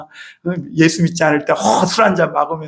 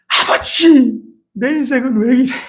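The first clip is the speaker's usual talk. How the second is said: mock yell to God; 7 semitones higher than usual. Preacher mimics a believer yelling on the mountain, mock yell. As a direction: call out to heaven in half-mock despair. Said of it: "막 이러면서 소리 질러 보신 분".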